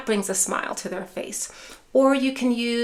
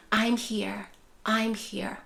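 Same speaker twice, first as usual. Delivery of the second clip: soft, quiet, slow "I'm here"; gentle reassurance; 6 dB quieter, slower than usual. Shown as a demonstration. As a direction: wrap them in calm with your voice. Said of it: "you're reassuring".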